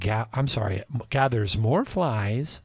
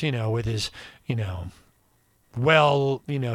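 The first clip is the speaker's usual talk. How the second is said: normal talking voice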